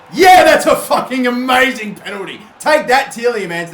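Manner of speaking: loud, high gloating cheer; triumphant shout. Real, not acted. Gamer gloating at the rival after scoring his penalty, triumphant shout. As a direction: rub it in, celebrating.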